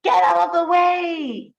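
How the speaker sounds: high, loud yell. Acted. Sheep yelling at the stuck duck, high and loud. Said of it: "and he yells".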